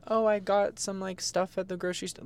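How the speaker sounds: high, light imitation of mom; changing the subject. Mom diverting her upset daughter, high and casual. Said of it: "change the subject".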